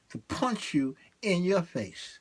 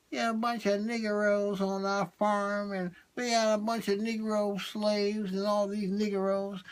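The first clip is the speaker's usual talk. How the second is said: put-on southern accent; 3 semitones higher than usual. Southerner talking about the farm, mock Southern accent. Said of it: "they have their southern accent".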